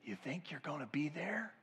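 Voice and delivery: hushed, breathy whisper to the room. Real, not acted. Pastor whispering a challenge to the congregation.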